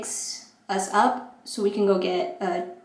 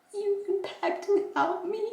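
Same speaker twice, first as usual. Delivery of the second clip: much higher, softer squeaky whine of dismay; high mock whine; 11 semitones higher, 6 dB quieter than usual. To her sister and friend, a high squeaky mock whine. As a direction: whine it like a let-down kid.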